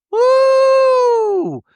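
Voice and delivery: loud, very high, long "woo"; scandalized whoop. Real, not acted. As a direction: let out a piercing held whoop at the shade. Reacting to a shady remark.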